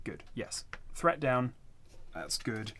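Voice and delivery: ordinary talk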